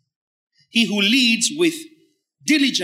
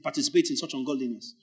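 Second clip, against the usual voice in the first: ordinary delivery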